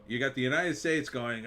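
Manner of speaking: ordinary talk, nothing marked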